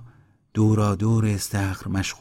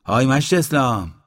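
loud, higher-pitched hail; raised voice hailing a man by name; loud call; 5 semitones higher, 6 dB louder than usual. A narrator's reading. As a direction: call out to someone across the way. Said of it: "با صدای بلند گفت".

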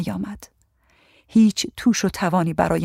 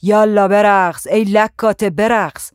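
loud, higher, drawn-out order to dance; shouted command; 3 semitones higher, 5 dB louder, slower than usual. A narrator's reading. Tyrant ordering a frightened girl to dance, shouting. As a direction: shout it slowly, stretching the words. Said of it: "فریاد زد".

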